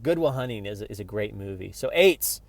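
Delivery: ordinary talk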